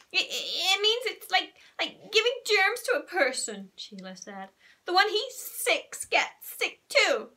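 a hint of a bratty little girl complaining; tattling girl Sheila. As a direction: tell on him to the grown-up. Sheila explains, slow.

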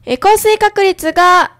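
clear, full-voiced radio announcer reading the weather and traffic; ラジオの天気予報読み. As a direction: read the forecast like a community FM host, bright and steady. (ラジオパーソナリティの明るくはっきりした天気・交通情報の読み)

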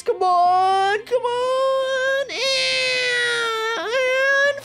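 drawn-out, rising strained plea; playful straining. Pretend. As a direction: stretch the words high, as if pushing through. Straining through lasers in game.

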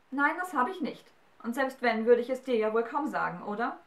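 put-on a stubborn girl snapping back; defiant. A narrator's reading. Galatea defies the giant questioning her, defiant. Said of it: "entgegnete sie trotzig".

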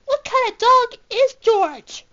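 can't believe what came out; loud, high, bewildered asking; baffled. Acted. Baffled at the vet's finds.

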